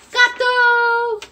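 high, loud cheerful answer; excited reveal. Shown as a demonstration. Teacher revealing the answer to her class, high and bright. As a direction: announce it like a game-show win.

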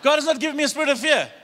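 high, loud and fast; loud proclamation. Shown as a demonstration. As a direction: ring it out for the crowd. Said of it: "I'll read, you do the boom".

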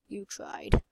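a soft, teasing whisper of "you tried"; whispered taunt. Pretend. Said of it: "says it a third time whispered".

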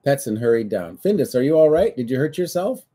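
lively read-aloud storytelling; story narration. A narrator's reading. Reading the Findus book to children, lively storybook voice. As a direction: read the book to a child, playing every part.

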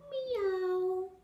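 high, drawn-out kitty meow; cat meow. A narrator's reading. Someone hidden behind the kennel, high cat meow. Unseen someone meows in story.

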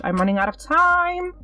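high, rising wail of worry; panicked whine. Real, not acted. Player watches the timer run out, panicked whine. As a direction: whine as the clock runs down.

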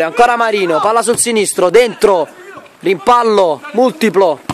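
ordinary delivery

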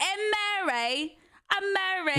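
slow, lightly mocking British voice; a hint of posh British; British accent. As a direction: play a prim British girl introducing herself. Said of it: "'cause she was like".

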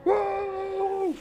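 high, startled squeal; whoa. Real, not acted. Streamer reacting to the board, high whoa. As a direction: yelp it up high. Streamer celebrating big play.

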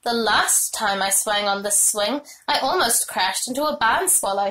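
ordinary delivery

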